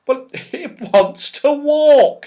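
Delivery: high, loud laughing line. Acted. Beth amused by the walking clock, laughing. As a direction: laugh through the words. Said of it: "with a scream of laughter".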